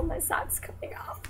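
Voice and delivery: unvoiced whisper to herself; whispered aside. Real, not acted. Vlogger muttering about her sock, whispered. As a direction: murmur the aside under your breath.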